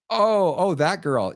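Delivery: high, sudden "oh" of fake remembering; hammed-up dawning surprise, pitched high; mock sudden recognition. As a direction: play innocent as if it just came back to you. Man playing dumb on phone.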